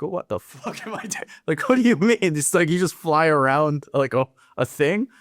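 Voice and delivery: high-pitched retold incredulous questioning; bewildered disbelief. Newcomer sitting in the briefing, retold bewilderment. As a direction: act out baffled alarm, pitch jumping up.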